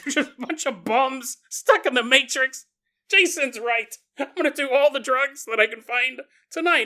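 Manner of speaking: high, loud hammed-up outburst; mock riled-up listener. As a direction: play a convert getting carried away, pitched high. Acted listener taking him too seriously.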